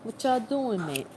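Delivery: jokey, badly faked British accent; mock British accent. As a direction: play at sounding British. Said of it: "speak in a British accent".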